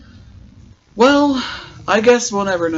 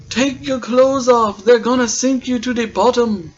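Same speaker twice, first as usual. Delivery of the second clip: bad Australian accent; 4 semitones higher, 4 dB louder than usual. Vlogger mimics the lifeguard Trevor in a bad Australian accent. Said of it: "I cannot do an Australian accent".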